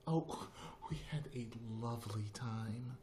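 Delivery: prim imitation with a little laugh; Darlene. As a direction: imitate the proper neighbor, slightly high. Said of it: "That's how she talked".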